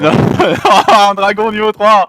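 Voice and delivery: high, loud laughing cry; excited laughing shout. Real, not acted. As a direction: burst out laughing at the reveal. Excited reveal, laughing and crying out. (cri de joie en riant)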